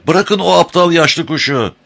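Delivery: high, urgent yell; wailing shout. A narrator's reading. Amber urging Penrod to abandon the bird, crying out. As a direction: cry out in alarmed exasperation. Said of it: "diye feryat etti Amber".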